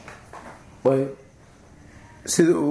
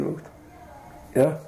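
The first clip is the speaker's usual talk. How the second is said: ordinary delivery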